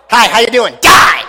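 high greeting jumping to a shouted command; mock boy king. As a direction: play a pretend tyrant, erupting on the last word. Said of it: "Tyler sitting on his throne".

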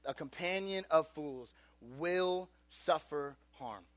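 slow, soft, weighty delivery; solemn scripture. Real, not acted. Preacher telling teens what the Bible says, grave and slow. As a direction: let each word land quietly.

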